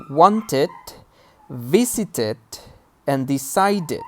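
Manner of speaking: "wanted", "visited", "decided" with -ed as its own -id syllable; full -ed ending. Shown as a demonstration. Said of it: "we pronounce them as E D".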